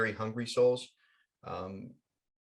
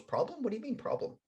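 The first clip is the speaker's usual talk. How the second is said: high, rising, softer query; acted innocent surprise, pitched up; puzzled questioning; 9 semitones higher, 5 dB quieter, faster than usual. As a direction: echo it back in bafflement. Angel puzzled by Pip's complaint.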